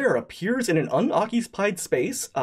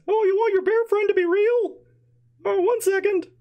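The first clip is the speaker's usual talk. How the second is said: very high sly goblin voice; squeaky and scheming; 3 dB louder than usual. Acted. Goblin Prince voice.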